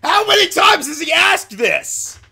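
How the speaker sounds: high, drawn-out yell of exasperation; exasperated shout. Real, not acted. Player fed up with the repeated question, exasperated shouting. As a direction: shout it slowly in disbelief.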